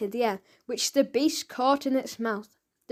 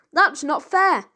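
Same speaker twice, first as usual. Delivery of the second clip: higher and louder protest; 5 semitones higher, 5 dB louder than usual. A narrator's reading. The boy protesting his dad's debt, higher and louder. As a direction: object hotly. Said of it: "protested Frank".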